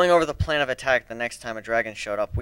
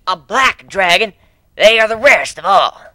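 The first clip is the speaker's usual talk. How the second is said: a hint of an old elder, higher and louder; elder proclaiming; 8 semitones higher, 12 dB louder than usual. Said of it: "the elder proclaimed".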